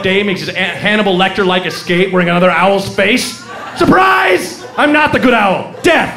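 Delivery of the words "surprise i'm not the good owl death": high, gleeful shout; murderous owl ambush. Acted. Escaped owl ambushing owner, high menacing shout. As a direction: burst out high like an attacking owl.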